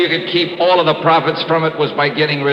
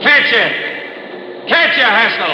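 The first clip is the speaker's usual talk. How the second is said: taunting accusation; 8 semitones higher than usual. Acted. Detective confronting killer with gun.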